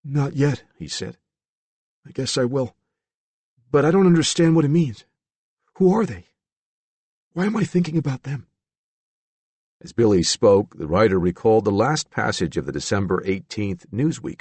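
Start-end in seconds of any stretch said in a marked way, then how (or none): 0.0-8.5 put-on higher, bewildered young voice; lightly voiced anxious young man; troubled young man. A narrator's reading. Billy voiced higher throughout.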